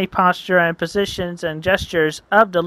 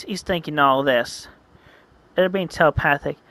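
plain reading voice

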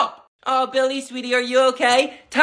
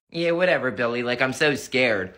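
low gloating grandma; 13 semitones lower, 4 dB quieter than usual. Acted. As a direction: sneer it like a smug grandma. Grandma taunts the boy.

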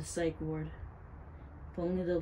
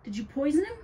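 loud, high-pitched suspicion; alarmed accusation; 9 semitones higher, 6 dB louder than usual. A narrator's reading. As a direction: demand an answer in alarm. Girl accuses witch of poisoning.

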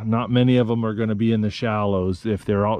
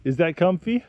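gentle check-in; 7 semitones higher than usual. Real, not acted. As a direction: ask him tenderly, like a parent. Checking Nathan is comfortable.

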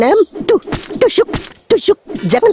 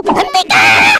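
high shout to stop; much slower than usual. Acted. Stopping a quarrel.

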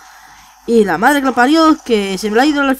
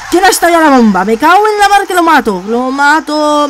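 higher, louder furious rant, lo mato slowed right down; loud exasperated fury, half joking; angry outburst; 4 semitones higher, 5 dB louder than usual. Real, not acted. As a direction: blow up at whoever set off the bomb. Teammate blew up the bomb.

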